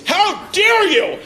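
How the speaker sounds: high, slow, affected "How dare you?"; mocking a man's prissy retort. Man snapping at his wife in a prissy mock voice. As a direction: camp it up, drawn out and way up high. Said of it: "you ever say the phrase".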